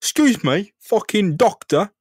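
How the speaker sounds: high, loud, mouthy British geezer rant; British thug Spider-Man. Pretend. British Spider-Man refuses to pay the doctor, loud British rant. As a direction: play a mouthy British lad kicking off at the doctor. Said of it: "Imagine if Spider-Man was British".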